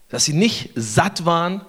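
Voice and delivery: usual speaking voice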